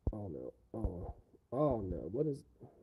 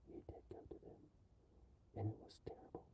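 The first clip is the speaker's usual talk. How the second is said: hushed mock threat; creepy whisper; 19 dB quieter, much faster than usual. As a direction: whisper it like a horror villain. Whispered horror narration.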